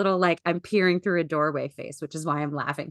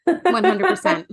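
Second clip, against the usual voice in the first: laughing; 11 semitones higher, 6 dB louder than usual. Real, not acted. Laughing agreement.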